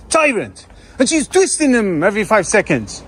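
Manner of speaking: ordinary delivery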